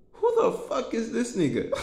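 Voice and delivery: high-pitched imitation of baffled onlookers; mock disbelief. Imitating people seeing him show up, mock disbelief. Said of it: "they look at me like".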